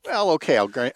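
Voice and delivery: ordinary talk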